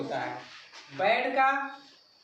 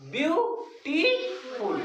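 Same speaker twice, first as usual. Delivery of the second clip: Beautiful very slow as byu, tee, ful, pitched higher; three separate syllables; 5 semitones higher, much slower than usual. Shown as a demonstration. Teacher shows the class three syllables, beautiful slowly broken up. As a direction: stretch beautiful into three high, drawn-out parts. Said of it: "तीन syllable का है".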